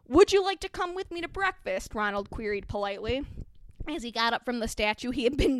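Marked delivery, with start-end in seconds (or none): 0.1-1.9 eager invitation. Acted. Eager boy invites friend.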